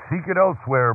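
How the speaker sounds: firm, high-pitched refusal. A narrator's reading. Boy innkeeper turns away Joseph seeking lodging, firm. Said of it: "Brent said in a firm and deep voice".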